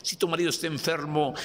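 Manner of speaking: ordinary delivery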